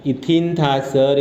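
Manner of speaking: slow, deliberate ethene; slow recap. Shown as a demonstration. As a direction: stretch ethene so students remember. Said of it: "देखा था ना तुमने यार". (धीरे से इथीन)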